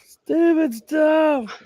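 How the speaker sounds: high slow mock echo of stupid stuff; high drawn-out repeat. Real, not acted. Repeats phrase in marked way.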